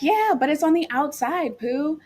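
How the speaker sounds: put-on high-pitched singsong to a child; retold motherly reassurance. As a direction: imitate a mom reasoning with her kid. Mom voiced reasoning with scared daughter.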